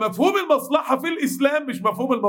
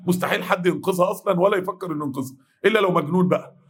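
normal talking voice

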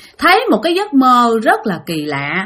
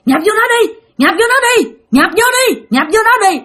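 high, urgent repeated command; insistent calling out; 11 semitones higher than usual. Acted. Old woman calls the boy while choking the sister, insistently. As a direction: keep urging at a much higher pitch, relentless. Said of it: "Bả cứ liên tục kêu".

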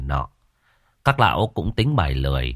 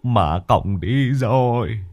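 muttered lament; 4 semitones higher, 3 dB louder, slower than usual. Acted. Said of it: "mà lẩm bẩm".

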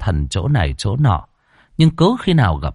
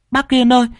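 put-on a high, loud child's scream of panic; little girl screaming; 5 dB louder, faster than usual. A narrator's reading. Little girl screaming to her uncle about the blood, high and loud. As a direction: shriek for your uncle, terrified. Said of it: "con bé hét lên".

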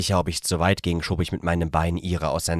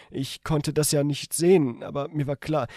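higher female character voice by mistake; misread in woman's voice; 10 semitones higher than usual. Shown as a demonstration. The reader wrongly voices narration as the woman, higher. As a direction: read narration as her line. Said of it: "Anführungszeichen!".

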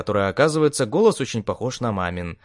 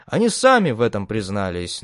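ordinary delivery

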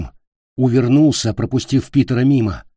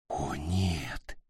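hushed, unvoiced whisper; horrified whisper; 6 semitones lower, 13 dB quieter than usual. A narrator's reading. Питер whispers over the wounded владычица, whispered. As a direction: whisper it under your breath. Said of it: "прошептал он".

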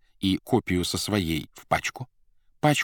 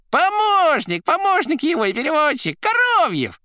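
high, loud, rattling patter; crackling chatter; 7 dB louder than usual. A narrator's reading. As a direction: rattle it off like a jester. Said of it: "трещала трубка".

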